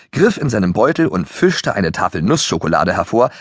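usual speaking voice

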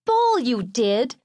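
put-on high, drawn-out kid's jeer; scoffing kid brother. A narrator's reading. Youngest brother disputing who said grace, scoffing.

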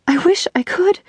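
choked-up plea, pitched high. A narrator's reading. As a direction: voice climbs high through tears. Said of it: "interrupted by her tears and sobbing".

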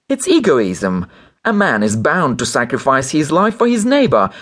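high, agitated accusation; indignant demand. A narrator's reading. Wife threatening the doctor who refuses, indignant. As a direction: rise into a distraught threat.

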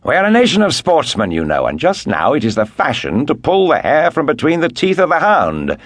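hearty, loud and high Englishman; jovial loud voice. Acted. Lord Antony telling the Comtesse, jovial and loud. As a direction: boom it out like a cheerful sportsman. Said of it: "with his jovial, loud, and pleasant voice".

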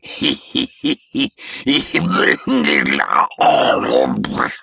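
put-on breathless gurgling old-man laugh; wheezy ghost chuckle. A narrator's reading. Ghost laughs at his own story, wheezy chuckle.

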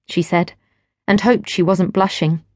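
ordinary delivery

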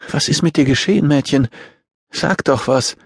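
high-pitched, concerned woman; urgent pleading. A narrator's reading. Woman urges child to speak.

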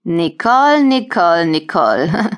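each Nicole tuned differently, grinning and taunting; mocking singsong. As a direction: toy with her name like a cat with a mouse. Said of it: "Sophie grinst mich breit an".

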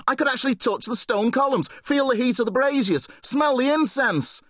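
put-on high, fast-rushing boyish gush; excited babbling. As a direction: tumble out the words like a thrilled kid. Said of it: "babbling like a little kid".

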